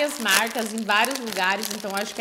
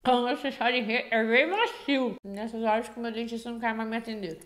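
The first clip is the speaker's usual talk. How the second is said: chewing while talking; biting hard sweet; mouth full of candy. Real, not acted. Seen 0: Bites firm sweet with teeth, chews visibly; bag opening after.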